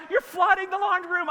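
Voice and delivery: high, loud imitation of alarmed daughter; retold frantic shout. Daughter to dad as laundry room floods, frantic shout. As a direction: holler it like a scolding kid.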